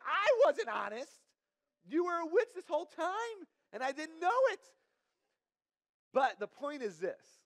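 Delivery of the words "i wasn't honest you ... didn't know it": high, loud mock outrage; indignant comeback. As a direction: fire the blame back at her, indignant. Arguing back at ex-girlfriend.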